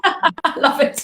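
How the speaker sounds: breathy laugh through the words; enjoy the joke as you say it; amused laugh. Real, not acted.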